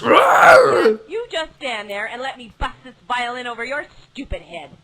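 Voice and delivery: furious yelling threat; angry shout. Acted. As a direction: yell it in a rage. Game character yelling threat.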